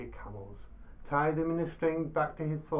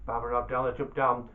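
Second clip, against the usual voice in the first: usual speaking voice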